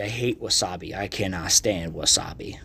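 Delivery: disgusted whisper. Real, not acted. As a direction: breathe it out in disgust. Muttered disgust at wasabi.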